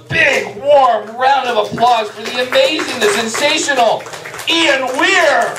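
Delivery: big showbiz announcer introduction; announcer build-up. Acted. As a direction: sell the guest like a game-show host. Host hyping guest's entrance.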